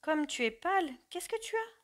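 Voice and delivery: ask, taken aback by her pallor; very surprised. A narrator's reading. Son surprised at his pale mother, concerned. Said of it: "très surpris".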